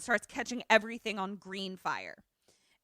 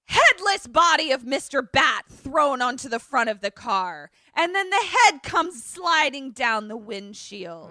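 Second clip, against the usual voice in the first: loud, raised, breathless-climax narration; dramatic storytelling; 4 semitones higher, 8 dB louder than usual. Real, not acted. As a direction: belt out the shocking twist.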